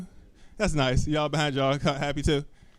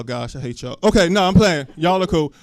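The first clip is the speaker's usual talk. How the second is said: ordinary talk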